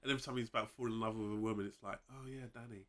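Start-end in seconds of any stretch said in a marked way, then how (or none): 2.1-2.9 whispered swoon; soft, breathy infatuated murmur; lovestruck Jorah impression. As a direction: whisper it like a besotted knight. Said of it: "I don't know why he has that voice".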